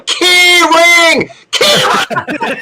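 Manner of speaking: shrill-pitched imitation of a yelling kid. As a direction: holler it high like a heckling child. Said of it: "This kid starts yelling".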